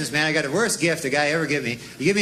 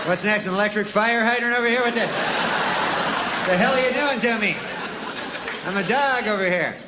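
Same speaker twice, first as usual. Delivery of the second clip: higher, exasperated complaint; indignant dog; 4 semitones higher than usual. Acted. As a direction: plead like a wronged dog. Dog complains about gifts.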